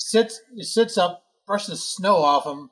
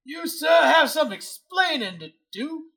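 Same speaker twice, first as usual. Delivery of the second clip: lightly voiced blustering Southern rooster accent; Foghorn Leghorn; 4 semitones higher than usual. Chorog demanding answers from Eli in a Southern bluster. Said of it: "Foghorn Leghorn".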